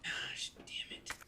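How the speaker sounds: breathy whispered swear under breath; whispered curse. Real, not acted. Quiet curse.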